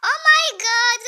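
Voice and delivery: high, loud, slow oh my god; overwhelmed by the page; drawn-out shocked cry; stunned shout. Real, not acted.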